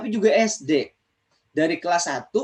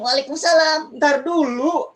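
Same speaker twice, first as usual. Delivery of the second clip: much higher, eager puppet voice; puppet friend voice; 8 semitones higher, 4 dB louder than usual. Acted. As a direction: pitch it way up like a hand puppet. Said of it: "Temen Kak Ojan udah nggak sabar".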